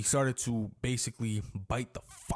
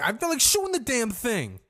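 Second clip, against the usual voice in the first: much higher, louder mock rant; dad fed up; 11 semitones higher, 6 dB louder than usual. The bitten dad about his dog, a high loud mock rant. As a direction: imitate the dad's furious outburst. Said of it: "He's like".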